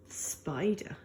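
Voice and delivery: whispered creature name; a soft storyteller's whisper; hushed whisper. A narrator's reading. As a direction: breathe the name out secretly.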